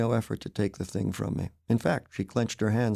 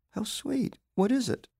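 sweet wife voice; 12 semitones higher than usual. Acted. Connie's sarcastic reply to gift.

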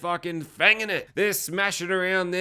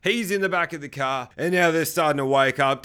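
ordinary delivery

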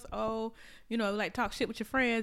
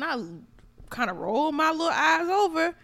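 ordinary delivery

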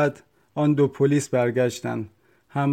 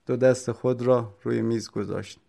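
ordinary delivery, nothing marked

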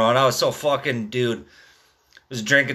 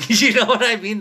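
high, louder laugh running into the words; 8 semitones higher, 4 dB louder than usual. Real, not acted. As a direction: laugh while you say it. Laughs at his own flagging question.

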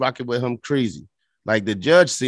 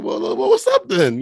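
mock high greeting; slower than usual. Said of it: "I said".

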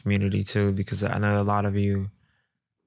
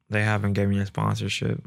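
normal talking voice

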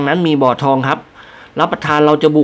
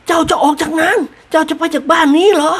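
loud lady; 13 semitones higher than usual. A narrator's reading. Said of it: "พูดเสียงดัง".

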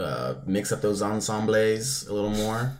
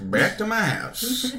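slow, higher Southern drawl on house; southern accent; 12 semitones higher, slower than usual. Pretend. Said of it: "my southern accent coming through".

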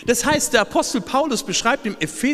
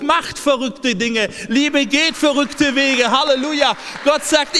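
fervent revival preaching; preaching at full force; 5 semitones higher than usual. Acted. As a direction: preach it out to the whole church, building to a shout. Preaching on God becoming human.

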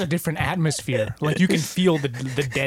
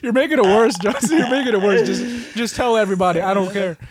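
high, loud, comic exasperation; exasperated retold plea; 7 semitones higher, 6 dB louder than usual. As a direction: protest loudly at an over-sympathetic friend. Retelling his plea to Johnson.

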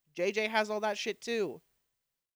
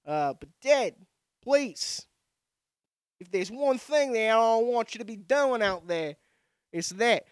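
mock accent somewhere between New York and Australia; muddled Australian accent; 3 semitones higher, 3 dB louder than usual. Sons pleading with their dad, in a mock Australian accent. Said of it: "Somewhere between New York and Australia, you find that accent".

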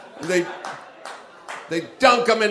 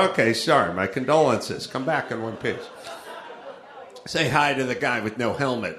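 mock condolence; 6 semitones lower, 5 dB quieter than usual. Acted condolences for trip to Wisconsin.

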